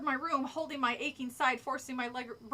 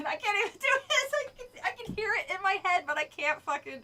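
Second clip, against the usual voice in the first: ordinary delivery, nothing marked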